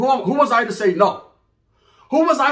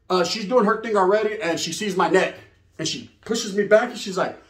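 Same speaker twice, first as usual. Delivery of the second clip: ordinary talk, nothing marked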